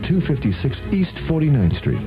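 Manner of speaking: ordinary talk, nothing marked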